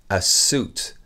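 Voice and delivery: "a suit" said soot, with no i; no i sound. Shown as a demonstration. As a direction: drop the i in "suit", just soot. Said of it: "ignorer le i".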